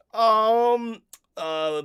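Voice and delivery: ordinary talk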